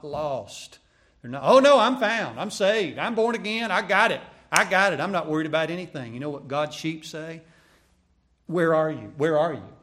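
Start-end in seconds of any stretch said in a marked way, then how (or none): 1.4-6.1 high, loud, brash protest; mock self-assurance. Preacher mimicking people sure they are not lost, brashly self-assured. 8.5-9.8 loud, urgent call. Acted. Preacher as lost sheep calling for the shepherd, urgently.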